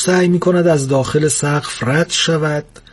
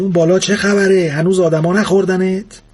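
calling up from grave; 5 semitones higher than usual. Acted. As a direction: call up out of the grave.

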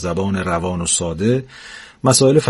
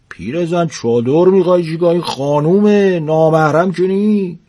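put-on higher, needling voice of an old man; teasing old husband; 7 semitones higher than usual. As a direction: tease the fussing wife. Old husband mocking wife.

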